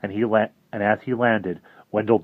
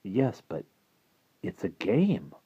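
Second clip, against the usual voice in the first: hesitant; 6 dB quieter, slower than usual. Acted. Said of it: "Harvey said".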